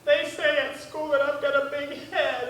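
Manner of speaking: high-pitched mock-tearful child's whine; crying little kid. Kid tells mama about school, crying like a baby. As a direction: wail it like a bawling seven-year-old. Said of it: "he's crying like a baby".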